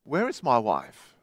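low, soft, despairing cry. A narrator's reading. Lost man asks again for his wife, in a low, soft despairing cry. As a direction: call out quietly, already losing hope. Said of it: "Again he cried".

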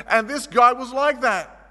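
ordinary talk

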